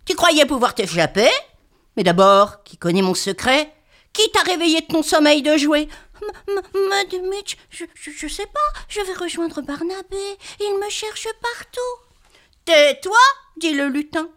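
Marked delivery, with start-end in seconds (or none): 0.1-5.9 loud, gruff goblin voice. Acted. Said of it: "d'une grosse voix". 6.2-11.8 frightened stammer. Acted. 12.7-13.2 loud, sharp command; angry order. Acted. Goblin orders silence.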